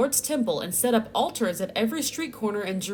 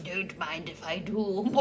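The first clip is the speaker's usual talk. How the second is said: sly greedy voice; 4 semitones lower, 7 dB quieter, slower than usual. Retold or imitated. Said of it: "Babylonians are like".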